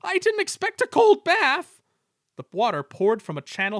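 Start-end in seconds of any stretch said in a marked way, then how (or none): 0.0-1.8 shivering. A narrator's reading. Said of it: "he shivered".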